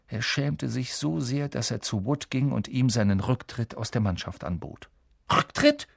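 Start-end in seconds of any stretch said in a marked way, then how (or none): 5.3-5.9 high, outraged exclamation; thundered incredulous question; thundering. Acted. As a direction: explode in disbelief on one word. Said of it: "donnerte Wood".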